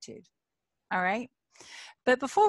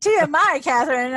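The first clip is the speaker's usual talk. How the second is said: loud, high teasing jab; a playful too-much-information call-out; joking tease; 5 semitones higher, 9 dB louder than usual. Real, not acted. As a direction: tease her playfully for oversharing. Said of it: "I had to make a joke".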